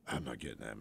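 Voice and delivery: low, hushed, scared of the phone; soft paranoid hush; paranoid whisper. Retold or imitated. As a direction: hide from the ringing phone.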